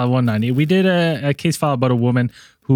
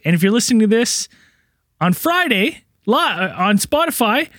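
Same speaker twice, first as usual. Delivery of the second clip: higher and faster as it goes; rising excitement; 10 semitones higher than usual. Real, not acted. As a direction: voice climbs with nervous energy. Rambling correction, pitch rising.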